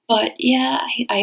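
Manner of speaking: ordinary talk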